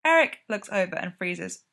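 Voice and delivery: ordinary delivery, nothing marked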